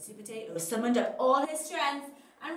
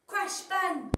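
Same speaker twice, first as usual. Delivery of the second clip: usual speaking voice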